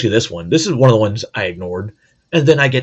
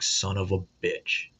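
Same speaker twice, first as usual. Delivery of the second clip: muttered under breath; 3 semitones lower, 10 dB quieter than usual. Retold or imitated. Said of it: "still had that look like".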